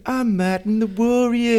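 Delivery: mock Liverpool narrator voice; Scouse accent. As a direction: ham up a thick Scouse accent. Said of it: "the strongest Liverpool accent".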